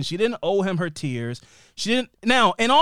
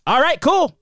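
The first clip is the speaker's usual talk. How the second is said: much higher, louder offhand quip; mock casual brother; 9 semitones higher, 5 dB louder, slower than usual. Brother reacting after a gun scene, high mock casual. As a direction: shrug it off in a high silly voice.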